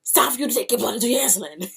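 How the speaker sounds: fast, high, loud scolding; panicked mother's shout; mother yelling. Retold or imitated. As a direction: yell it at her like a frantic mom. Mother yelling at sick daughter.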